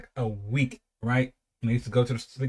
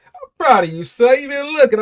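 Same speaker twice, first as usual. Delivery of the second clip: higher, louder, beaming praise; proud mom; 12 semitones higher, 7 dB louder than usual. Retold or imitated. A mother praising her son's Kool-Aid, higher and proud. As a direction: praise your son with pride. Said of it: "She was like".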